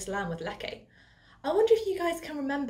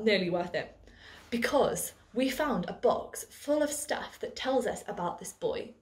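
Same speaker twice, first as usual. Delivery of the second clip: ordinary talk, nothing marked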